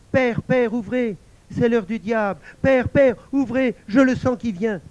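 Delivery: high, loud, desperate plea; panicked cry. Acted. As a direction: cry out to be let in, terrified. La fille poursuivie supplie son père. (appel affolé, aigu et fort)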